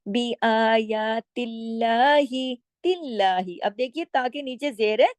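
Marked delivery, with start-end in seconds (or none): 0.1-3.6 "आयातिल्लाही" slow with a light, thin lam; recitation demo. Shown as a demonstration. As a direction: recite "तिल्लाही" thin, not heavy. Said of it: "फिर आप बारीक ही पढ़ते".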